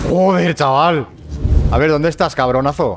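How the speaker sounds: loud, high frustrated yell; yelling at the game. Real, not acted. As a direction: shout it at the enemy. Gamer yelling at enemy. (gritando al juego)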